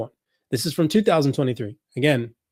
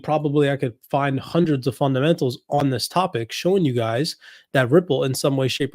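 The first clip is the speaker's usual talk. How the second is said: ordinary talk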